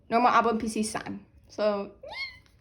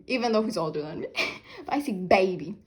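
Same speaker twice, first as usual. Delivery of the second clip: ordinary delivery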